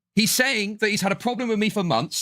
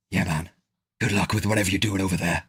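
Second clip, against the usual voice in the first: much lower, deadpan cool-guy voice; lowered mock of a cool brush-off; 10 semitones lower than usual. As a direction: play it like John Wick shrugging someone off. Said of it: "he's the cool guy".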